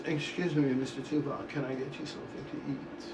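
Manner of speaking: soft, hushed, fast obsequious handler; impression of the handler. Handler quietly asking the star if he wants food. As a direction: fawn over him in a hushed rush. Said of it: "that was the best fucking impression I ever did".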